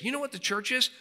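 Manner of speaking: high, slow, mock-naive tone; voicing a simple churchgoer's idea; wide-eyed naive take; naive mock quote. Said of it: "the average person ... thinks".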